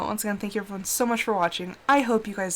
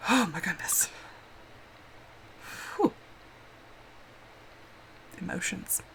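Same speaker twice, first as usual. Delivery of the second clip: tearful; 3 semitones lower, 13 dB quieter than usual. Real, not acted. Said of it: "Emotions are high right now".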